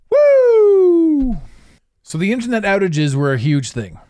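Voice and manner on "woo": loud, high drawn-out woo; whoop. Real, not acted. Podcaster shaking off a rant, loud high whoop. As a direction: let out a long, high whoop.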